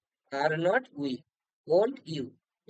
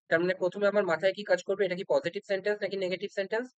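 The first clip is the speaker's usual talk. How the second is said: usual speaking voice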